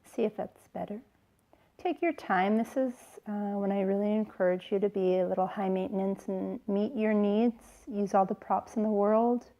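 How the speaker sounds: usual speaking voice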